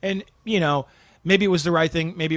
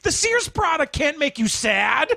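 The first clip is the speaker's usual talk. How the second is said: much higher, slow, half-laughing; laughing exasperated shout; 12 semitones higher, slower than usual. Retold or imitated. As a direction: scold the actor. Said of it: "the guy from Sears goes".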